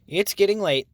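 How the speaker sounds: brisk morning call; lightly voiced Lil. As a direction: wake them up briskly. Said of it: "said Lil".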